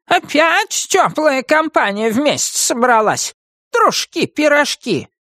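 high, drawn-out witch scolding; mock the company in a high witch voice; scornful witch. Witch greets the cosy gang of pals, scornfully.

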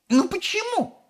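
very high-pitched squeal of a question. A narrator's reading. Mother questioning Wonka, squealing. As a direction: squeak it out in exasperation. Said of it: "взвизгнула миссис Глуп".

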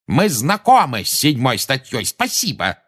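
testy official calling out; irritated shout. A narrator's reading. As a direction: cut the lecture off loudly. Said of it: "крикнул Фадж".

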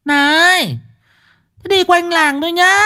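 put-on high-pitched fussing old woman; old wife calling out. Old wife calling after the car, high and fussing. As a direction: scold them as they drive off.

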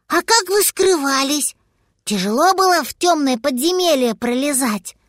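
put-on high, curious childlike chatter; little dragon voice. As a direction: chatter like an innocent kid.

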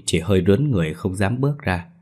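ordinary delivery, nothing marked

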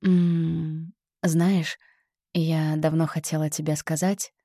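warm, close breathy confession. Shown as a demonstration. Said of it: "Попробуйте сейчас". (тёплое придыхание)

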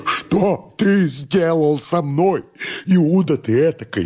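high, drawn-out anguished accusation; frenzied rage. Acted. Master accuses servant of betrayal, frenzied high outcry. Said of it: "теряясь от бешенства".

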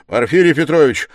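loud and distinct. A narrator's reading. Suspect confronting the investigator, loud and distinct. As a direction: call the name out loud and clearly. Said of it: "проговорил он громко и отчётливо".

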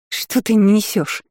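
slow, shaky frightened squeak; nervous trembling squeak. A narrator's reading. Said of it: "нервно дрожащим голосом пискнула".

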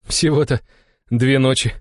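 high, light easy father; light reply. A narrator's reading. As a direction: toss it off lightly. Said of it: "легко отозвался Иван".

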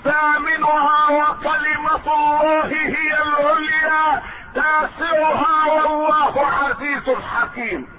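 high, ringing sermon declamation; high-pitched oratorical recitation; full-force preaching. Acted. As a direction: proclaim each point to the congregation, voice held high. Preacher enumerates the verse's parts.

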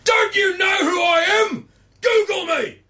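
high, drawn-out screech of outrage; self-important Sydney shout. Retold or imitated. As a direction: shriek it like an entitled somebody. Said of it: "people who will do this".

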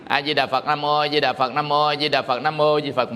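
low, even monotone recitation; demonstrated Buddha-name recitation. Retold or imitated. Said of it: "Ví dụ như ổng là". (niệm Phật đều đều, giọng trầm)

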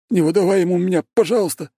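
high-pitched terrified pleading; fearful bleating. A narrator's reading. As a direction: bleat it out like a coward begging. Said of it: "проблеял от страха Косицкий". (испуганно блеет, высоким голосом)

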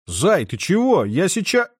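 high, loud alarmed call; startled worry for her. Acted. Anton alarmed. (встревоженно)

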